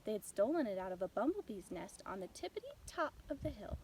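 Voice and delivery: ordinary delivery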